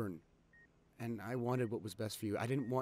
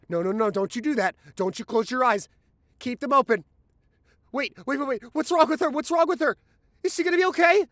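frantic, much higher and louder, voice rising to near shouting; panicked pleading; 18 dB louder than usual. Acted. Boyfriend panicking as paramedics treat her, frantic pleading. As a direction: beg them desperately to tell you she'll live.

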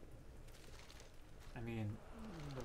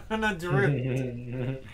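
laughing; 6 dB louder, much slower than usual. Real, not acted. Laughing at the druid ride.

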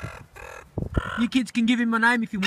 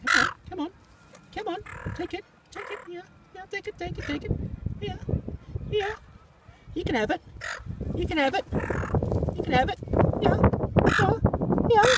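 coaxing; 9 semitones higher than usual. Real, not acted. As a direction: sweet-talk the bird into taking the food. Coaxing a bird to take chicken.

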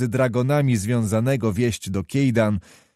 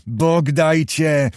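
slow, rising repeat; 4 semitones higher, much slower than usual. Acted. Kmicic, choking with anger at the lady, slow and rising. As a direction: force the words out, slower and higher.

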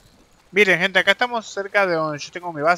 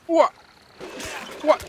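battle grunt; 12 semitones higher than usual. Real, not acted. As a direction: shout as the blow lands. Attacking the bull ant. (grito de esfuerzo)

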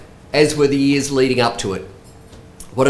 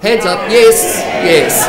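higher and louder, rallying the room; excited call to crowd; 6 semitones higher, 7 dB louder, slower than usual. Real, not acted. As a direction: whip up the crowd for a yes. Urging the audience to raise hands.

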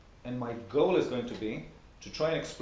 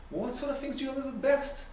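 Alice; 12 semitones higher than usual. A narrator's reading. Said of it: "Alice ventured to ask".

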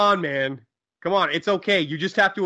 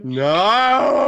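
high, loud screams of dread; horrified wail; 7 semitones higher, 7 dB louder than usual. Acted. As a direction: wail in hammed-up despair. Horror at announced film.

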